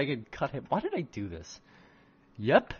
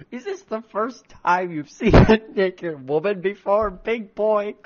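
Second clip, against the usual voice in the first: loud, high mock-seductive tease; hammed-up flirty witch voice; sultry teasing witch; 7 semitones higher, 8 dB louder than usual. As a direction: purr it out as a cheeky temptress. Witch character teasing Mies.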